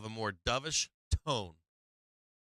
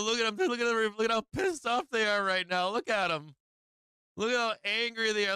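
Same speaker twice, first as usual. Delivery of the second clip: gleeful play-by-play of the chart; excited; 7 semitones higher than usual. Real, not acted. Streamer showing chat the candles, excited and higher.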